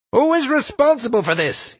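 very high-pitched roar of outrage; enraged bellow. A narrator's reading. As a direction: bellow as if across a whole campus. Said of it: "he bellowed".

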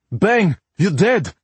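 put-on cab driver in thick Russian accent; urgent, broken English, heavy Russian accent. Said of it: "in a thick Russian accent".

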